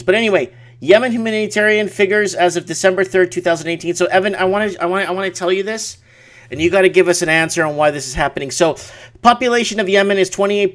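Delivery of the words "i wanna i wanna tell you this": ordinary talk, nothing marked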